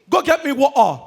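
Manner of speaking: high, loud London demand; put-on London accent. Returnee ordering water from waiter, London accent. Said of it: "The only thing he came with, accent".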